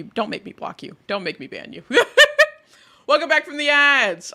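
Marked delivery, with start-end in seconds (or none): 1.9-2.5 high burst of laughter. Real, not acted. Laugh at own threat to chat.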